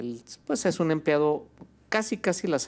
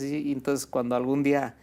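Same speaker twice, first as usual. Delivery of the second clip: ordinary talk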